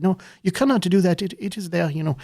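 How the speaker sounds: a stern Belgian official refusing; Belgian accent. Retold or imitated. Council refusing the tree cutting, in a Belgian accent. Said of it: "What kind of accent is that?".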